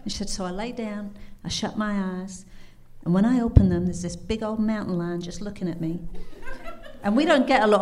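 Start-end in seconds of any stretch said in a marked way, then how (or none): 0.3-6.0 amateur Kentucky twang for a weary woman; bad Kentucky accent. Cabin owner recalls being worn out to her guest, Kentucky accent. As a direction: retell it as a tired Kentucky woman. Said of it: "my very bad Kentucky accent".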